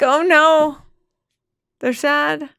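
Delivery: high, loud, fretting; mock panic. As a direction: play up the worried parent. Host imitating her own worry.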